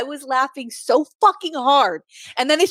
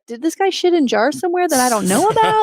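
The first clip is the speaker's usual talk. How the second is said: incredulous quote; 6 semitones higher than usual. Retold or imitated. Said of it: "And I was like".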